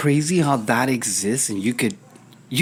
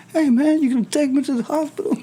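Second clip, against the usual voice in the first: high, pleading patient voice; mock patient, pitched high; 13 semitones higher than usual. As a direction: imitate the patient asking for the hospital. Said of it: "This is how all patients sound to me".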